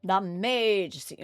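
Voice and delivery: very slow, dragged name; slow, drawn-out game title. Shown as a demonstration. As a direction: stretch the title out slowly. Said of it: "I'm gonna stop saying it like that".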